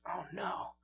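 hushed, sinking realization; quiet acted dismay. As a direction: say it almost under your breath. Husband learns wife left him.

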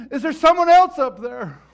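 high, very loud pleading call upward; desperate shout. Acted. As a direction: holler for anyone else up there. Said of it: "And he goes".

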